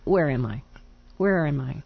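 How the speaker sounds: ordinary talk, nothing marked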